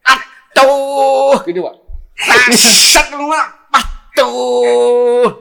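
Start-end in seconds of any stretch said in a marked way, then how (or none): 0.1-1.4 loud, high "atuh" with a long held vowel; drawn-out mock scream; imitated pain scream. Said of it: "Teriak, Pak". 2.2-3.1 loud, high "tak, set"; imitated slicing noise. Replays the cut before the scream. 3.7-5.3 loud, high "atuh" with a long held vowel; drawn-out mock scream; imitated pain scream. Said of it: "Teriak, Pak".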